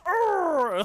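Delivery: long, loud, high mock groan; gremlin groan. Imitating the stunned killer's noise, loud gremlin groan. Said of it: "a gremlin".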